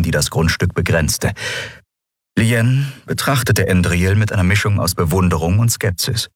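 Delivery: ordinary delivery, nothing marked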